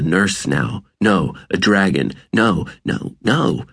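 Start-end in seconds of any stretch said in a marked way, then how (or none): none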